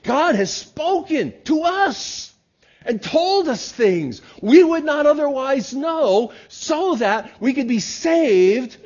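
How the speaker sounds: slow, high and loud pulpit proclamation; full-force preaching. Acted. Pastor to congregation on salvation, slow loud preaching. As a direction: proclaim it to the back pew.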